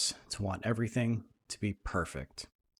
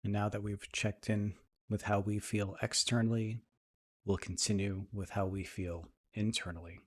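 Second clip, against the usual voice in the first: soft, even meditation voice with long pauses; guided meditation; 4 dB quieter than usual. Acted. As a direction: ease listeners into calm, leaving space between phrases.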